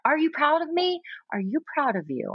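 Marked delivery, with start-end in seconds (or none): 0.0-1.0 put-on much higher, eager little kid's question; child voice. Mom echoing her daughter's plea, much higher. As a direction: pitch it way up like a needy child.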